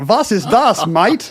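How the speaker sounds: a souvenir shopper's Aussie twang, played for laughs; mock Australian accent. Host jokes as an Aussie at a souvenir stand, mock Australian accent. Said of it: "that's my Australian accent".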